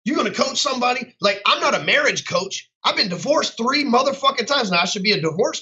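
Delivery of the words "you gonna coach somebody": raised pitch, heated and scornful; heated rant, voice pitched up; angry rant. Real, not acted. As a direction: tear into fake coaches. Ranting at fake coaches.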